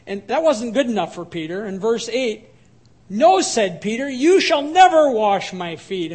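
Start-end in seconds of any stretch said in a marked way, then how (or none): 3.1-3.4 much higher and louder refusal; emphatic no. Retold or imitated. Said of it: "giving emphasis". 4.1-6.1 louder and slower, "you" stressed; emphatic refusal. Retold or imitated. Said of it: "giving emphasis".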